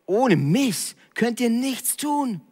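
high, very slow voice of Jesus; Jesus speaking. Retold or imitated. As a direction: lift it up high and drawn out as Jesus. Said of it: "Jesus sagt".

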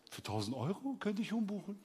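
soft, matter-of-fact counter voice; cool clerk offer. Retold or imitated. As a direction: state the price quietly, unbothered. Clerk calmly offers an expensive rebooking. (leise, ungerührte Schalterbeamtin)

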